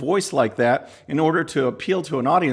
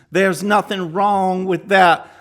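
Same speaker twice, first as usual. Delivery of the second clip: higher, louder, slow mock Southern twang on that; 6 semitones higher, 5 dB louder, slower than usual. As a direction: ham up a put-on Southern accent, dragging out "that". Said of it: "her twang is the strongest at the end".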